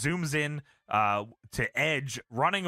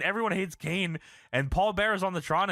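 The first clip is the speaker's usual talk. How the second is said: ordinary talk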